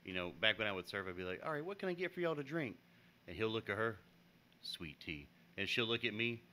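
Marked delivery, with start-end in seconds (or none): 1.5-2.8 high, quick server patter; server's greeting. Acted. Server asking the table for drinks, high quick greeting. 4.6-5.3 low, soft order to a companion; quiet aside. Acted. Man orders to her, ignoring server, low quiet aside.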